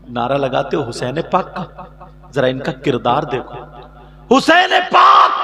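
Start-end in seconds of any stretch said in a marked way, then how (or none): 4.3-5.3 high, loud, slow name called out; fiery preaching cry. Acted. Preacher proclaiming Husain's name, loud. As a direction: ring the name out to the crowd.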